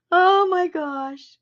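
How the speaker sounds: high slow amused exclamation; slow laughing oh my gosh; amused exclaim. Real, not acted. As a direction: drawl it out, amused. Laughing over two puppies.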